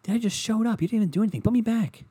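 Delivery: very high kidlike mock voice; imitating his son. Newborn son telling dad to put him back, very high. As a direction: squeak it like an indignant baby. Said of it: "He'd be like".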